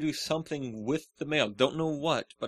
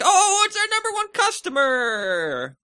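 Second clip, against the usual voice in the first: excited greeting; 8 dB louder, slower than usual. Retold or imitated. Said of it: "people were like".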